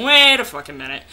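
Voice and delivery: loud exasperated interruption; sudden annoyed burst; frustrated outburst. Real, not acted. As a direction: blurt it out, fed up. Reading aloud, frustrated outburst.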